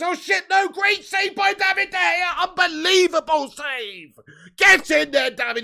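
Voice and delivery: very high, loud roar at a save; excited shouting. Real, not acted. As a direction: yell it out like a thrilled fan commentating. Reacting to a great save.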